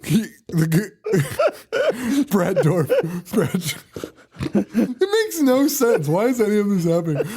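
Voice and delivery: high, laughing disbelief; helpless laughter. Real, not acted. Cracking up over the senseless plot, laughing incredulously. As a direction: fight through real laughter to get the words out.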